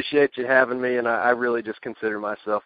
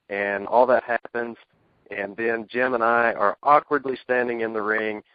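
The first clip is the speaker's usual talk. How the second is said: normal talking voice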